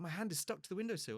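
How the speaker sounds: a hint of panicked begging; pleading over a stuck hand; desperate pleading.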